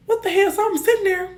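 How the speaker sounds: high, startled what the hell; jolted into a yelp; shocked. Retold or imitated. Right after the car hits them, a shocked high cry.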